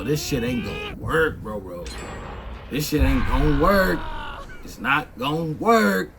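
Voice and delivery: normal talking voice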